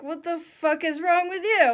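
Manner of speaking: retold outburst at a whining kid; exasperated mom. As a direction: demand it, fed up and alarmed. Mom snaps at crying child.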